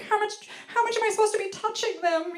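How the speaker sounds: high, fretting mock-parent voice; anxious new parent. First-time parent fretting over touching baby, anxious. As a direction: play up the first-time panic.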